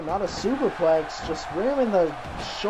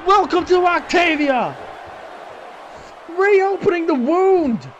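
higher shouted callout; 8 semitones higher than usual. Acted. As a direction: roar the move like a headline. Hyping the attacker's move.

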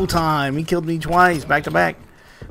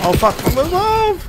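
startled yell; 4 dB louder than usual. Real, not acted. Startled in firefight.

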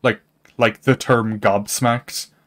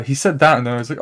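ordinary talk

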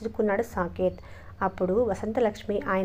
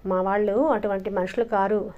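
usual speaking voice

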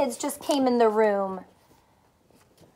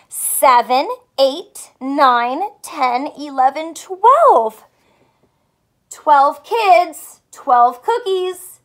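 louder, building to a bright twelve; loud counting; 10 dB louder, slower than usual. Acted.

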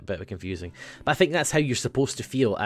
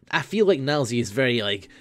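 ordinary talk, nothing marked

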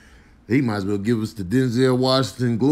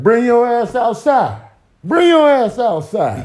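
loud high street challenge; personified word picking a fight; 10 semitones higher, 11 dB louder than usual. Acted. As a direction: call him out to fight, hammed up. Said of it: "Beginning was like".

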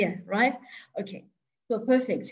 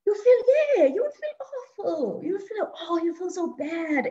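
much higher, pained voice of sudden guilt; sympathetic dismay; 7 semitones higher than usual. Pretend. Teacher to nurses picturing the stabbed wife, pained dismay. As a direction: sound stricken, as if it hurts to realise.

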